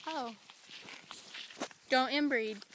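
usual speaking voice

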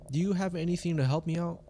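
ordinary delivery

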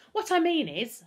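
high, loud, hurried backtracking. A narrator's reading. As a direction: jump in hastily to explain. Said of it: "Humphrey said hurriedly".

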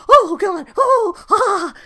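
loud, very high shrieks from icy water; cold-shock yelps. Retold or imitated. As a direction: yelp as the cold water hits. Phil in freezing shower.

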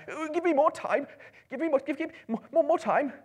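high, loud, desperate plea; panicked shout. Acted. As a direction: beg for time in terror. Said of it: "He wanted to shout out".

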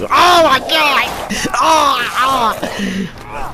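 high, loud, drawn-out panic shout; panicked shout. Real, not acted. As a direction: yell in terror, slowly.

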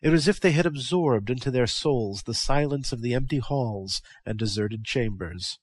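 ordinary delivery

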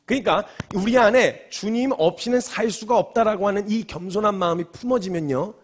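ordinary talk

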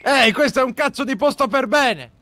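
high-pitched, exasperated telling-off; indignant reprimand. Acted. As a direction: lay down the rule to the staff. Boss reprimands the shouting employee.

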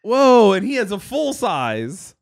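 normal talking voice

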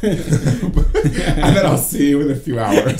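laughing, slow and loud. Real, not acted. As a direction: giggle through it slowly. Laughing at drugging joke.

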